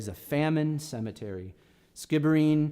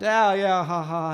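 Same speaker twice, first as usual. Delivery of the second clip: light laugh brushing off the joke; chuckle, thinking he's joking; retold laugh; 5 semitones higher, 7 dB louder than usual. Laughing off the gravedigger's request, a light chuckle. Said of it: "And I laughed".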